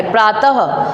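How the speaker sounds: "प्रातः" with the visarga heard as ह at the end; sounded final h. Shown as a demonstration. Teacher showing how visarga is pronounced, "प्रातः" ending in ह. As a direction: finish "प्रातः" on an audible "ha". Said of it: "इसका उच्चारण क्या निकलता है".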